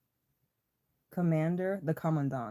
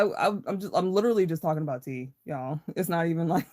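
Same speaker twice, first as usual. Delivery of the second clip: ordinary talk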